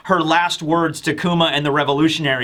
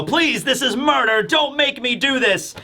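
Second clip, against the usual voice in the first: high-pitched mock pleading; imploring; 7 semitones higher than usual. Vegapunk begging Saturn not to force murder, imploring. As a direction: act out a desperate plea, pitched up. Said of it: "Vegapunk is imploring Saturn".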